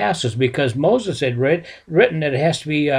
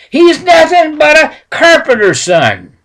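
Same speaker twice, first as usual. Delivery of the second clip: much higher, much louder sneer; scornful shout; 13 semitones higher, 10 dB louder than usual. Retold or imitated. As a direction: spit it out with contempt. Priest scornfully shouts down rabbi.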